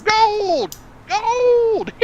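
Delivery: high, loud prospector yell; old-timey miner voice. Shown as a demonstration. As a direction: shout it like a gold-mad miner. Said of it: "in your best, like, old-timey miner voice".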